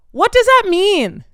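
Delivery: high, slow, incredulous; what on earth, said high and slow; outraged disbelief. Real, not acted. Outraged reaction to classmate.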